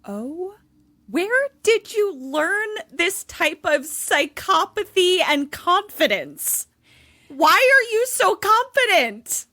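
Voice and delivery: high, drawn-out incredulous questions; appalled disbelief. Real, not acted. Babysitter reacting to twelve-year-old's creepy confidence, appalled disbelief. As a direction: demand an answer, pitched high in disbelief.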